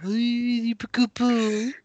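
mock Brummie, high and drawn out; Brummie accent. Host teasing his friend about pie remarks, mock Brummie accent. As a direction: tease him with a high-pitched Brummie impression. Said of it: "you said things in a Brummie accent".

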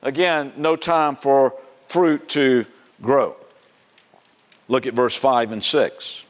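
ordinary delivery

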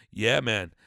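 normal talking voice

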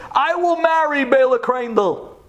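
high, ringing declaration; voice calling out. Acted. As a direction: announce it from the back of the room. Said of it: "suddenly a voice came".

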